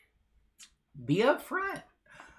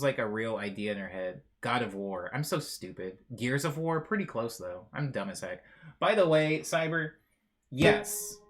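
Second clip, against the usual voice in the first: ordinary talk, nothing marked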